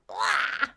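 high-pitched mock "wah"; sudden exclaimed wail; imitated wail. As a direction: burst out with a short, high mock cry. Imitates sudden realisation as a noise.

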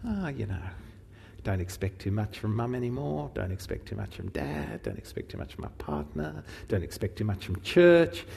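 mock resigned family withdrawing; resigned avoidant murmur. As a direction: say it low and quiet as if to yourself. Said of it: "distance yourself from each other".

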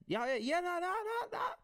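high, rising crowd chatter. Retold or imitated. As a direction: imitate a family all chiming in at once. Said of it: "when everyone's kinda like".

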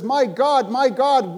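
loud, high anguished cry to God. Acted. Jesus crying out from the cross, loud and high.

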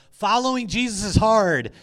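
loud, high conference-speaker proclamation; imitated rally shout. Imitating a conference speaker addressing a cheering crowd, loud rally shout.

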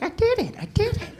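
squeaky high falsetto whine; protesting little brother. Acted. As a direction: insist like a small kid nobody believes.

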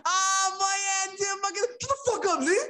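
high mock yell; Tim snapping at Lee. Tim snapping at Lee, high mock yell. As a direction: tell him off. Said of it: "That's Tim talking to Lee".

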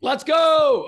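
loud, high whoop of triumph; excited cheer. Real, not acted. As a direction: cheer the lucky roll at full voice. Cheering a natural twenty roll.

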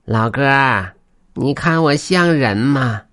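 high, slow creature voice; put-on weasel voice; weasel spirit asking. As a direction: draw the question out like a hopeful animal spirit. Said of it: "黄皮子询问道".

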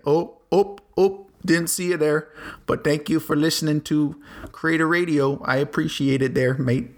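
hammy Canadian thank-you, not very convincing; awful Canadian accent. Retold or imitated. Podcaster thanking Canadian listeners, in an awful Canadian accent. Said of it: "That was an awful Canadian accent".

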